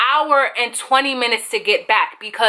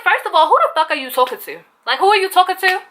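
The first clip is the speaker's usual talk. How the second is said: retold indignant challenge, higher in pitch; going off; 6 semitones higher, faster than usual. Employee confronting rude Cha-Cha, retold going off. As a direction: challenge the manager, pitch climbing. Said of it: "So I start going off".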